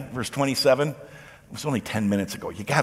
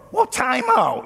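much higher, taken aback "time out"; startled disciple; 10 semitones higher, 3 dB louder, slower than usual. Pretend. Disciples startled as Jesus finally accepts the title, high and surprised. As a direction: pull up short in surprise.

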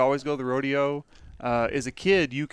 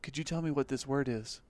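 hushed classmate; 6 dB quieter than usual. Retold or imitated. Rodeo student to classmate in speech class, hushed. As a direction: lean over and ask it on the quiet. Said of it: "and he goes".

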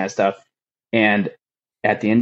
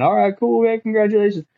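high, breezy buddy voice; cheery coworker; 12 semitones higher than usual. Retold or imitated. Coworkers sending him off, a higher, breezy congratulation. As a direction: toss off a casual congrats, higher pitch.

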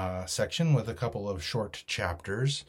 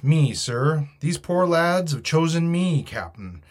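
raised, louder character voice; Long John answering; 6 semitones higher, 7 dB louder than usual. A narrator's reading. Long John explains his title to the captain, speaking up. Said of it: "Long John answered for himself".

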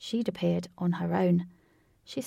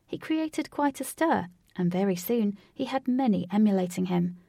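plain reading voice

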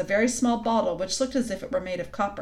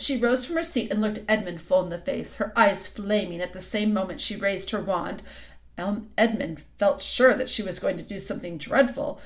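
ordinary delivery, nothing marked

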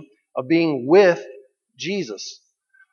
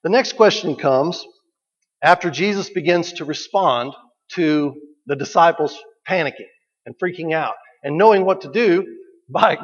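ordinary talk, nothing marked

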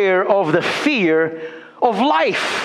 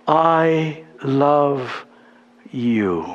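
tender whisper of love; 8 semitones lower, much slower than usual. Acted. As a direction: say it gently, as a loving father. God's tender words to believers.

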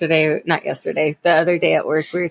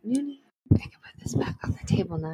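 unvoiced whisper, hushed; 2 semitones higher, 7 dB quieter, faster than usual. Real, not acted. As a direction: keep it to a hush so nobody hears. Quietly whispering while the pet is away.

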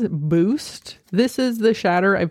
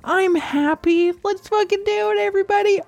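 excited; 9 semitones higher than usual. Real, not acted. Hyping the channel birthday.

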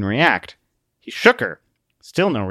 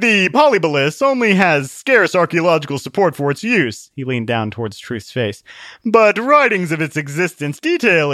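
smug lecturer; 5 semitones higher, 5 dB louder than usual. A narrator's reading. Wiseman lectures smugly.

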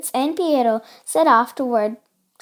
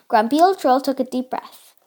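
ordinary delivery

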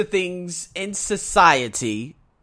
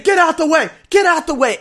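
high, loud runner's yell; screaming; 10 semitones higher, 7 dB louder than usual. Retold or imitated. Runner to people in the way, screaming. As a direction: yell to clear the path at full effort. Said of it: "I'm screaming".

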